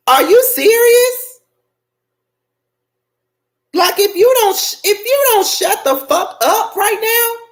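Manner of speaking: high, loud, outraged tirade; furious rant. Real, not acted. Viewer scolding Giselle for chastising Ashley, furious high rant.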